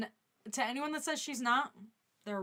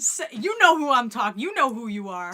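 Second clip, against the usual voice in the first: much louder, hammed-up fury; mock angry yell; 14 dB louder than usual. Calling out a viewer's comment, hammed-up angry yell. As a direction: blow up at the chat for show.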